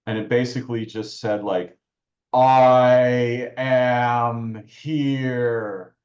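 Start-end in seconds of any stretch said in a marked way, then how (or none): 2.3-6.0 drawn-out, booming otherworldly voice; thunder-language voice. Retold or imitated. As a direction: imitate a thundering presence, very slow and loud. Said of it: "but in thunder language".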